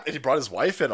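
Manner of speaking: ordinary delivery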